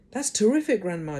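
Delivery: high, eager cry of delight; excited boy. Acted. Boy cheering his grandma on, excited. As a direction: George thrilled for Grandma.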